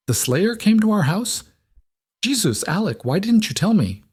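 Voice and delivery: high, shocked, rising to a near cry; as if the floor just dropped out; alarmed disbelief. Acted. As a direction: Jess reels at hearing the Slayer came to their house, alarmed. Jess learns the Slayer visited.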